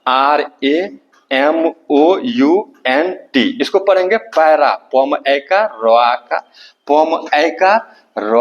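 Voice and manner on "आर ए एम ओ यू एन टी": letters dictated slowly one by one; slow classroom dictation; slow spelling out. Shown as a demonstration. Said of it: "देखिए P A".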